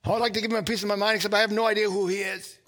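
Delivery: lightly played road-rage grumble; mock indignation. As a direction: spit out the grudge quickly. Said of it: "this agitation, this bitterness".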